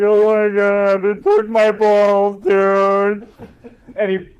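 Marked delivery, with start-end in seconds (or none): 0.0-3.3 slow, higher, louder dopey dog voice; dog's voice. Retold or imitated. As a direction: play the neutered dog, dazed and whining. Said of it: "He's like".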